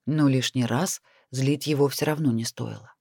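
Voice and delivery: ordinary delivery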